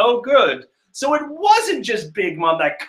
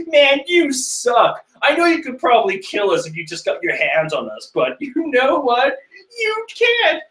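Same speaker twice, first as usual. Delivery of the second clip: mocking minion taunt; 10 semitones higher than usual.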